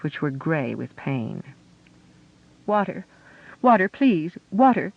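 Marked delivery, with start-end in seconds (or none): 2.7-4.9 loud, high feverish cries; feverish complaint. Acted. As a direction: cry out in fever. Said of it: "voice rose in feverish complaint".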